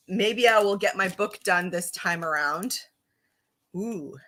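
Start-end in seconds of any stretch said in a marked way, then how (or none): none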